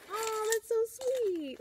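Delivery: very high, slow, syrupy mock coo; imitating sweet colleagues. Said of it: "And they're like".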